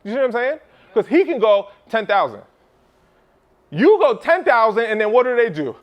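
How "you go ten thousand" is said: louder, higher, slow; emphatic. Real, not acted. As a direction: lean hard on you go ten thousand. Emphatic price point.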